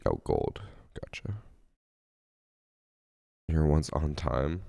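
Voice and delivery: usual speaking voice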